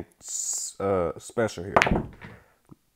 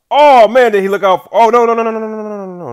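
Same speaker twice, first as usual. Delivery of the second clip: high, loud rapid run of no's; alarmed shout; 11 semitones higher, 16 dB louder than usual. Real, not acted. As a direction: yell in alarm, then start to fade. Alarmed at the rolling ball.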